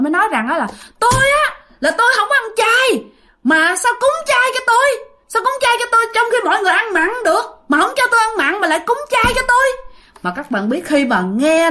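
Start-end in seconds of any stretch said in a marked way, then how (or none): 1.0-9.7 very high, insistent angry protest; imitating loud outcry. Possessed man protests the vegetarian offering, high-pitched yelling. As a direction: wail out the complaint at the top of the range. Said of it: "Thì cái anh này mới la làng lên".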